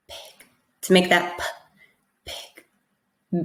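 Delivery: "pig" whispered, no voice. Shown as a demonstration. Teacher whispering pig to make the P voiceless. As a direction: breathe the word out. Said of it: "whisper the word if you need to".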